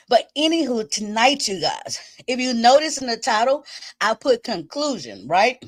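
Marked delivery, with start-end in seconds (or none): none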